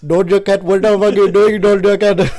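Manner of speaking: hammed-up Indian accent, loud and high. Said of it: "Am I hitting the Indian?".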